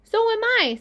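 a second tiny spider chiming in. A narrator's reading. Another baby spider answers Wilbur, tiny and high. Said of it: "said another tiny voice".

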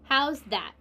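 punchy, pleased-with-himself question; triumphant. A narrator's reading. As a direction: show off the find proudly. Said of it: "he said triumphantly".

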